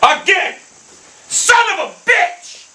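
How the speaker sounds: high, explosive yell; furious cursing. Real, not acted. Gamer cursing a bad shot, furious high shout. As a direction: scream it in real frustration.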